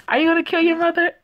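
high, loud, fast shocked question; a loud, alarmed gasp of a question. Real, not acted. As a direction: blurt it out in disbelief. Commentator reacts to gun scene.

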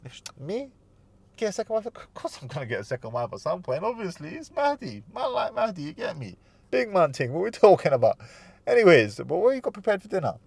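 high, breezy, joking dismissal; playful brush-off. Shown as a demonstration. Groom brushing off the second-wife question, high and playful. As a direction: laugh it off like it's obvious. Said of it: "You make light of it".

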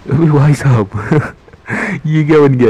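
high, loud laugh through words; laughing in disbelief. Real, not acted. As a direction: laugh while asking, stunned. Robot suddenly transformed. (हँसते हुए हैरानी से)

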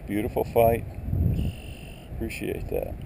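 usual speaking voice

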